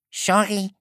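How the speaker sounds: high, meek duck voice; apologetic duck. A narrator's reading. Duck answering the annoyed unicorn, meek and high. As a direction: squeak out a small apology.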